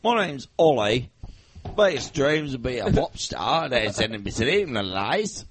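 put-on Essex impression. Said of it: "The next one is [name]".

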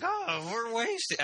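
high, slow tipsy voice; mock Jackie. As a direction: drawl it out like a giddy drunk. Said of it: "She's like".

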